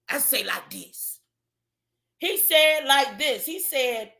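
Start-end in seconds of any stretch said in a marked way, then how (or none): 0.1-1.2 a lightly imitated, softer Cicely Tyson voice; a hint of Cicely Tyson, softer and lower. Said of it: "That's Cicely Tyson".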